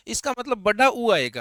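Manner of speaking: normal talking voice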